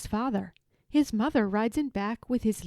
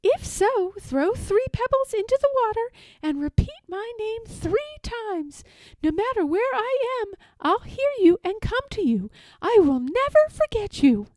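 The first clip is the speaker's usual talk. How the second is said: high, louder, earnest mermaid vow; mermaid's promise; 12 semitones higher, 5 dB louder than usual. A narrator's reading. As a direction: promise it from the heart, high and sweet. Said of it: "mermaid promising".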